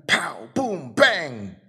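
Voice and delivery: playful onomatopoeia, each word swooping in pitch; comic-book sound effects. Shown as a demonstration. Preacher illustrating onomatopoeia with Batman, playful sound effects. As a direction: voice them like Batman fight noises. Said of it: "It's an automatopoeic word".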